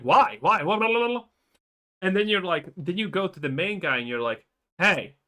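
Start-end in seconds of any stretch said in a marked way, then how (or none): 0.0-1.2 mock angry. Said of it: "he's pretty pissed".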